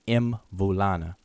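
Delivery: Mvulana stretched slowly, opening with im-; drawn-out wrong take. Shown as a demonstration. Teacher mimics the westernized im-vulana. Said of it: "pronounce it all the way out like that".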